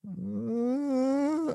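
long, high-pitched uneasy "mm"; held high hum of mock discomfort. Imitating actress uncomfortable around him, long uneasy hum. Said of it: "was a bit like".

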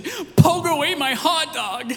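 put-on high, loud whiny toddler complaint; little kid voice. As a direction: imitate a three-year-old tattling, high and slow. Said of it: "this little three-year-old".

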